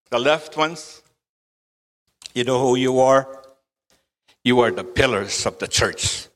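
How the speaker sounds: ordinary delivery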